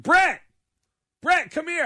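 high, loud call across a room. Retold or imitated. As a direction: holler to summon a friend over. Calls a friend over.

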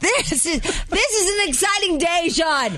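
high-pitched hammed-up thrill; gushing excitement. Co-host teasing Sean about the big day, excited. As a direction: gush as if overwhelmed by the news.

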